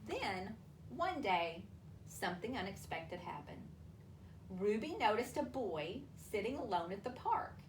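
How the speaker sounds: usual speaking voice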